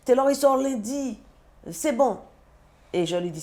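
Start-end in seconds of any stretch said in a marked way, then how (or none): 0.0-2.2 higher, slowed imitation of the husband brushing her off; husband's reassurance. Her husband telling her not to worry, in an imitating higher voice. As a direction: mimic him calmly insisting everything is fine. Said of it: "Il me dit".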